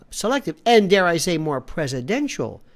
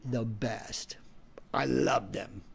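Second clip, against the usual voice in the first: breathy whispered plug; whispered aside; 7 semitones lower, 10 dB quieter, much slower than usual. Real, not acted. Plugging the car service, whispered aside. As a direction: whisper it like a secret endorsement.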